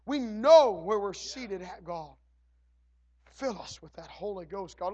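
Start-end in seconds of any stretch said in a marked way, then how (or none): none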